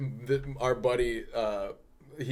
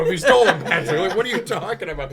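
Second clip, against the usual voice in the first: loud, high-pitched indignant protest, half laughing; heated retort; 7 semitones higher, 10 dB louder, faster than usual. Retold or imitated. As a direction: argue back, incredulous and amused. Acted argument with accusing roommate.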